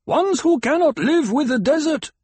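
high-pitched declaration from above; challenging call. A narrator's reading. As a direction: recite the law loudly across rocks. Voice from rocks argues down.

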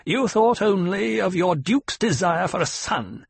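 put-on high, sharp old woman's snap; curt accusing snap; snapping. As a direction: cut her down harshly. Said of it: "the old woman snapped".

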